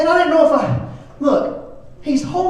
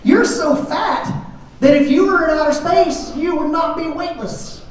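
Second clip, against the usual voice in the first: higher, slow, deadpan roast; teasing child; 8 semitones higher, slower than usual. Retold or imitated. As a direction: deliver the kid's insult slowly and innocently. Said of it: "and he says".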